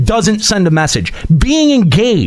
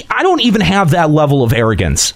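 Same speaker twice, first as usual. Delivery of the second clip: normal talking voice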